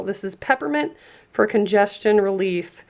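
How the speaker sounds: usual speaking voice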